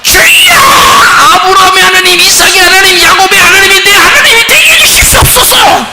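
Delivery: high, loud wailing prayer voice; loud, high hammed-up cry to God; mock pleading prayer. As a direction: parody a desperate begging prayer. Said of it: "그렇게 기도하세요. 그러면".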